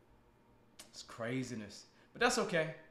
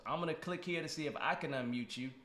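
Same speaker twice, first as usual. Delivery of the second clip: ordinary delivery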